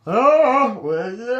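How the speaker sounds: put-on loud, swooping drunken slur; drunk woman. Drunk wife answering where she is, mock drunken confusion. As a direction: imitate a very drunk woman, starting loud and high. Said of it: "Her exact response was".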